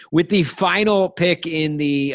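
slow, high, formal announcement; draft announcer voice. Acted. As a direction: announce the pick like a draft host.

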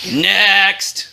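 drawn-out, weary call; bored dismissal. A narrator's reading. Bored king waves away another contestant, weary. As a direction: dismiss them with utter boredom. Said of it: "The king was so bored".

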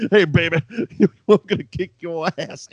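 high, loud cocky taunt with laughter; cocky tough guy. Retold or imitated. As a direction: taunt like a brash wrestler. Quoting Big Cat's challenge.

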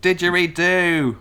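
hammed-up Australian accent, high and loud, drawn out; mock Australian. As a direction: belt it like a cartoon Aussie. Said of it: "Let's not do accents".

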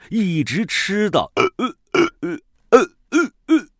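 high, loud hiccuping burps; burping. Acted. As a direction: gulp out burps after a feast. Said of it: "打嗝了".

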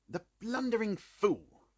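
high, slow bitter scorn. A narrator's reading. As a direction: spit it out bitterly. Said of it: "Holmes said bitterly".

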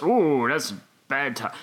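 normal talking voice

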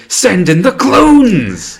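drawn-out, high-pitched mock battle cry; slow, grandiose movie-villain proclamation, played for laughs; hammy villain command. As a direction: stretch every word into a theatrical villain's call. Acting out the villain's big command.

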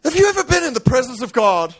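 high-pitched dramatic sermon telling; impassioned preaching. Acted. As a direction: preach the story at full pitch. Preaches at full force.

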